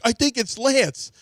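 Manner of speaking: slow, high mock accusation from the brother; brother's accusing drawl. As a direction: draw it out like a sly brother blaming him. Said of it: "My brother's like".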